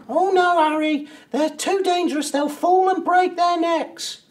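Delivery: very high, loud falsetto; mock her fussing in a slow, piercing falsetto; exaggerated wifely alarm, far above his own pitch; mimicking the wife. Man mimics his wife fretting.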